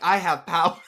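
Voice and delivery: loud, high proclamation of powers; mock grand declaration. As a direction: declare it like a kid witch. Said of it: "I was like".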